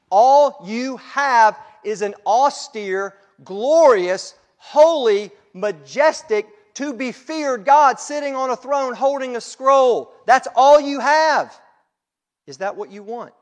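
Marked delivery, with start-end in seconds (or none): 0.1-11.6 much louder, high, emphatic build; full-force preaching. Real, not acted. Preacher warning of life without Jesus, thundering high and loud. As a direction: thunder out each weighty word.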